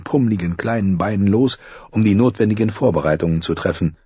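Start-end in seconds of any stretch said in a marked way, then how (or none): none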